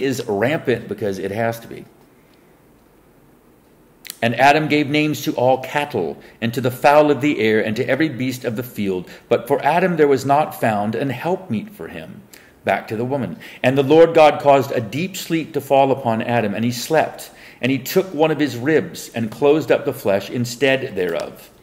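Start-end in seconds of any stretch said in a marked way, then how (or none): 4.2-12.2 measured Bible reading voice; solemn King James recitation; scripture reading. Acted. Reads scripture aloud. 13.6-21.5 measured Bible reading voice; solemn King James recitation; scripture reading. Acted. Reads scripture aloud.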